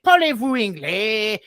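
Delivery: slow, hammed-up schoolboy French; broken French. A prank-calling teen asks the French operator in clumsy French. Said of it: "we'd be like".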